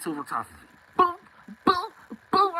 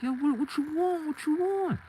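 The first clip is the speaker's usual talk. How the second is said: much higher, a cornered guy acting tough; squeaky hype-man challenge; high-pitched mock tough guy. Mans confronting after friend dropped.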